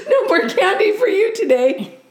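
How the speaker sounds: high mock scolding through a laugh; laughing order. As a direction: scold the bear while giggling. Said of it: "she ordered".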